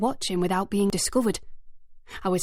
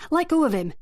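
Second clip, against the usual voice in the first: higher, louder command; commanding shout; 8 semitones higher, 3 dB louder than usual. A narrator's reading. Narrator ordering the gang to release Titus, shouting. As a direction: order them off him.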